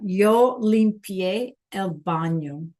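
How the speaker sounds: drawn-out Spanish model sentence; slow teacher reading. Shown as a demonstration. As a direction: say it slowly for struggling learners. Teacher prompts students with slow re-reading.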